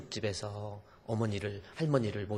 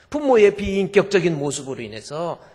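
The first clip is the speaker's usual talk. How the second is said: louder, higher; emphatic; 7 semitones higher, 13 dB louder than usual. Real, not acted. Preacher stresses the parents' wounds, louder. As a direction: press the point hard.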